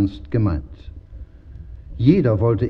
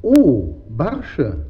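pleased. A narrator's reading. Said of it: "freute sich der zweite".

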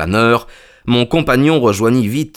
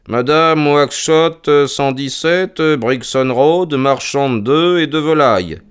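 higher, slow read-aloud with pauses; reading out an entry; 6 semitones higher, slower than usual. Acted. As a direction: read it out piece by piece. Reads the ledger aloud on demand.

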